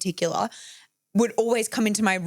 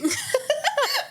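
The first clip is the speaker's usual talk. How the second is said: high burst of laughter. Real, not acted. Laughs at her own story.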